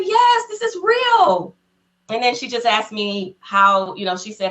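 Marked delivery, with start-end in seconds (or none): 0.0-1.6 playful Oprah. Retold or imitated.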